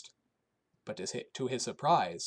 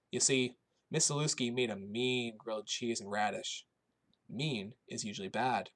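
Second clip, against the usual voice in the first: usual speaking voice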